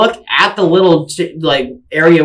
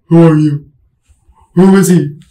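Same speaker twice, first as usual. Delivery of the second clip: louder, confused questions rising to a high call of Mom; confused, disoriented; 5 semitones higher, 7 dB louder, much slower than usual. Retold or imitated. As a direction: wake up lost and call for mom. Waking up confused after concussion.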